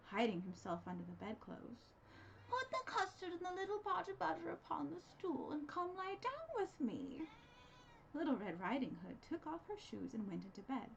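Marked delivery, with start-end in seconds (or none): 2.5-7.3 wolf as granny. A narrator's reading. Wolf luring the girl into bed, higher voice. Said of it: "said to her".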